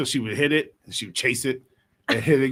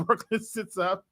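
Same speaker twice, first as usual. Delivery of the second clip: usual speaking voice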